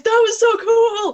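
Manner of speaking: high, loud, thrilled squeal; excited squeal. Retold or imitated. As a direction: squeal it out with glee, laughing.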